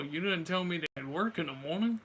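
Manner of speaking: ordinary delivery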